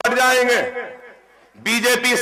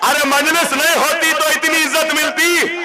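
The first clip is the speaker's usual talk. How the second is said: rally shout; 5 semitones higher than usual. Real, not acted. Rally speech, rising rhetorical demand.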